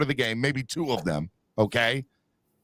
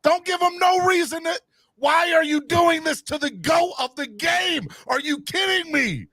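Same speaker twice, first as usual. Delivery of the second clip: frantic teammate. Retold or imitated. Memphis teammate yelling at Dylan Brooks, panicked shouting. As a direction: scream at him like a horrified teammate. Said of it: "I'm like".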